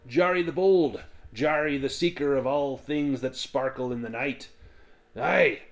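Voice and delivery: high, loud swaggering self-introduction; boastful dwarf Jari. Acted. Dwarf introducing himself to the tavern, loud and boastful. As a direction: crow your own titles proudly.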